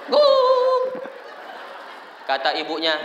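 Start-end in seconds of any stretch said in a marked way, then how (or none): 0.1-0.8 high, loud imitated howl; long drawn-out mock dog howl. Father quieting crying baby at night, howling like dog.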